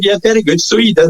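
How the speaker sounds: carried away. Retold or imitated. Cutting off a man insisting he did it, carried away and dismissive. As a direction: humour him without listening. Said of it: "totally carried away".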